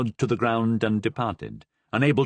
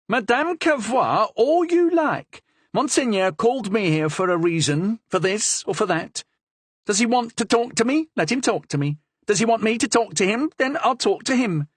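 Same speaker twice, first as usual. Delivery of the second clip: put-on lively young woman, higher, with a Languedoc accent; 11 semitones higher than usual. A narrator's reading. Madame Cavrois answers the Cardinal boldly, Languedoc accent. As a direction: bold Languedoc lilt, nose in the air. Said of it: "with a strong Languedoc accent".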